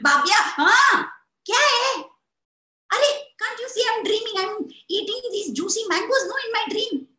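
high, loud, fast complaining; grumpy woken brother. Acted. Woken brother to sister, high grumpy complaint. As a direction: grumble at being woken.

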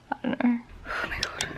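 whispered, spent sigh of words; exhausted whisper. Real, not acted. Vlogger worn out after a hard session, whispering.

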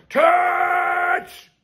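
high shouted cry, voice cracking with fervour; preacher's shout. Acted. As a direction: belt it like a tent-revival preacher.

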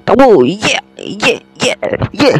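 high triumphant yells; excited cheering. Real, not acted. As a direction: cheer the win. Winning moment, cheering. (masiglang sigaw ng tagumpay)

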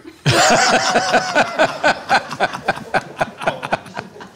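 high breathy laugh at the punchline; real laughter after the joke; burst of laughter. Real, not acted.

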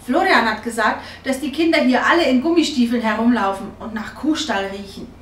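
put-on loud, sulky child's complaint; indignant protest. A narrator's reading. Girl arguing with mother about the village children, loud and indignant. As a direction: protest loudly, as if it proves the point.

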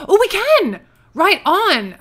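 gasp, then high, loud delight; thrilled surprise. Real, not acted. Gamer finding out they can get in, thrilled. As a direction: exclaim it like an unexpected win.

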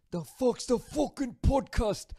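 high, slow, sing-song fake Scottish accent; bad Scottish accent. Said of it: "sorry for the bad accent".

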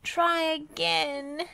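high, slow, stretched yell; impatient high-pitched demand; cross yell. A narrator's reading. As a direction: call it out crossly. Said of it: "yelled Dizzy crossly".

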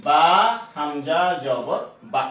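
হামজা জবর বা drawn out slowly; slow, deliberate sounding out for the learner; slow spelling out. Shown as a demonstration. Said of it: "এটা পড়বেন কি".

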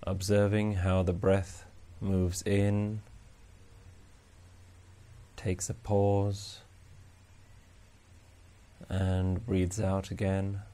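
slow, soft, even meditation voice; guided meditation. Acted. Meditation teacher guiding listeners to notice each breath, calm and unhurried. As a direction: guide them gently through the breath with long pauses.

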